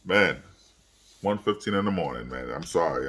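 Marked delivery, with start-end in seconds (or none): none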